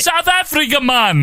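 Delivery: very high, incredulous Jamaican-accent imitation; mock Jamaican accent. Podcaster mocks the clip just played, high Jamaican accent. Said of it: "in a Jamaican accent".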